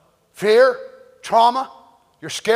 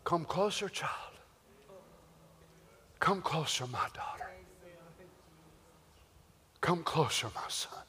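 soft, lower, gentle call; tender fatherly God; 5 semitones lower, 18 dB quieter than usual. Retold or imitated. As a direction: coax the child near softly. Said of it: "we would hear a voice".

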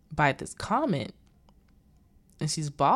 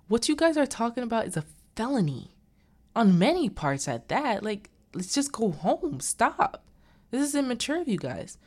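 higher-pitched, exasperated mock reprimand; scolding; 6 semitones higher than usual. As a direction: imitate him telling them off and pleading for sense. Husband scolds the plotters.

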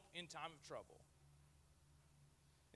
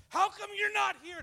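much higher, loud demanding cry; yelling at God; 12 semitones higher, 13 dB louder than usual. Retold or imitated. Believer in pain shouting at God, high and loud. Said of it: "when you're yelling, like".

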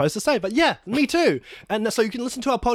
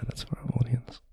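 low whisper to the audience; whispered aside; 8 semitones lower, 7 dB quieter than usual. Acted. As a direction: hush it as a side note.

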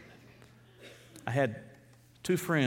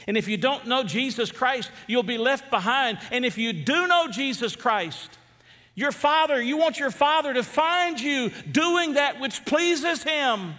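very high, loud and slow preaching; a pastor pleading with the church to be ready; full-force preaching; 13 semitones higher, 8 dB louder than usual. Acted. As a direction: lean in and hammer each phrase.

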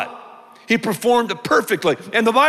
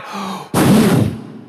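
breath of life. Acted. Preacher showing God breathing life, long audible exhale. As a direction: draw breath in, then breathe it out hard. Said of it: "breathing the breath of life".

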